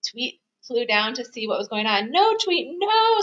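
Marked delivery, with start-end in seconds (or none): 2.1-3.2 high, squeaky mouse cry; high-pitched pleading mouse; squeaked warning. A narrator's reading. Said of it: "Scuttle squeaked".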